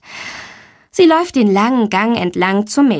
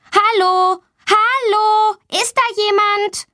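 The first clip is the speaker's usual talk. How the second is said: put-on high child's call for help, not much louder; 12 semitones higher, slower than usual. A narrator's reading. Girl locked in the toilet calls out for anyone, high. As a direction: call through the locked door. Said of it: "Sie ruft laut".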